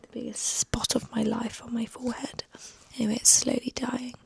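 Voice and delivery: normal talking voice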